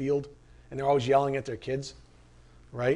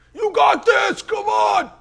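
much higher, loud coach shout from the infield; coach yelling; 7 dB louder, slower than usual. Retold or imitated. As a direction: yell it across the track at a tiring runner. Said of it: "there he is yelling at them".

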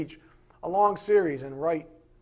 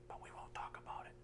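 hushed conspiratorial whisper; 20 dB quieter, faster than usual. Retold or imitated. Pastor hiding his scheme, whispering. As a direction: drop to a secret whisper.

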